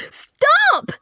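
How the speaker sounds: piercing, very high loud scream. Acted. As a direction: scream to stop him dead. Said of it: "Frankie screamed".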